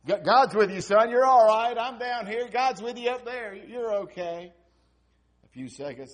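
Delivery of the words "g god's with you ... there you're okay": loud, higher reassuring shout; father calling up. Retold or imitated. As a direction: shout comfort up the stairs. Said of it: "Dad, you ever done that?".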